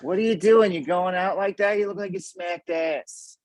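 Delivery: high, laughing mom scolding. Retold or imitated. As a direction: scold like a fed-up mother. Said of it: "something my mother would have said".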